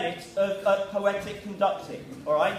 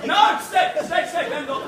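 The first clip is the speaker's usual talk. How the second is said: calling out; 5 semitones higher, 6 dB louder than usual. Real, not acted. Calling people back.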